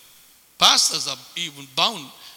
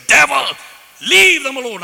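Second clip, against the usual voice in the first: loud, high shouted command to the devil; rebuking the devil during prayer, yelling; yelled rebuke; 8 semitones higher, 9 dB louder than usual. Retold or imitated. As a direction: bark it at the devil with full force.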